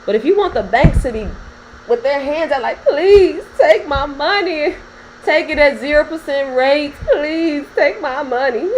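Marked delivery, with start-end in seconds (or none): 2.8-8.7 mock pleading bank. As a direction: beg like a desperate lender, half laughing.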